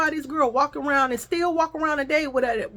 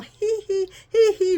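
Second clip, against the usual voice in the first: light high giggle at her phone; mimicked giggle; 9 semitones higher, slower than usual. Woman giggling at her phone, mock giggle. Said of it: "laughing and shit".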